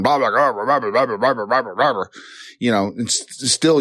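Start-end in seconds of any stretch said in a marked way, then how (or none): 0.0-2.0 mock rhythmic nagging chatter; nagging mom. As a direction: imitate endless run-on ranting. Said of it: "she keeps going and".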